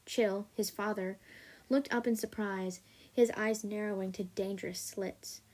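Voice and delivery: usual speaking voice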